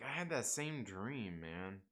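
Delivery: slowed, very soft, almost under the breath; hushed dream confession. Retold or imitated. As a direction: murmur it like a secret shared between friends. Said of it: "He's like".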